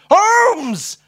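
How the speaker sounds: very high, drawn-out shriek; deafening exclamation. A narrator's reading. Watson ejaculates at Holmes in amazement, ear-splittingly loud. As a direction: bellow the name in astonishment. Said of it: "would have deafened the baby Jesus".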